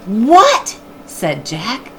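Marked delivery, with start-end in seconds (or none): 0.1-0.8 startled question. Acted. Jack startled.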